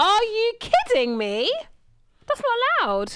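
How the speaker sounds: high, loud, drawn-out protest; mock outrage. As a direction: shout it in comic disbelief. Acted outrage at years passing.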